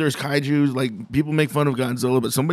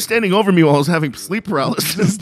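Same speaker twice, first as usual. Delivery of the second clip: mock accusation; 5 semitones higher, 7 dB louder, faster than usual. Acted accusation in imagined scene.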